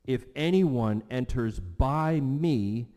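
slow and loud, leaning on ANYONE and ME; slow, preacherly punch on "anyone enters by me"; emphatic proclamation. Retold or imitated. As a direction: declare "by me" with full weight. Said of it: "that's emphatic also".

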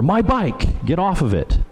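"My bike" thrown high up front, "get off of it" falling after; acted indignant kid, punch "My bike"; emphasis on BIKE. Kid ordering another off a bike, emphatic high "My bike". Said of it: "The emphasis is on the bike".

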